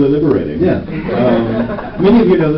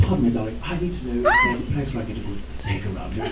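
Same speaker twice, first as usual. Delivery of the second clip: a softer, understated posh British purr; Sebastian's British accent; 4 semitones lower, 9 dB quieter, faster than usual. Retold or imitated. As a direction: charm the desk clerk in a mild English accent. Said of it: "I just did it in Sebastian's voice".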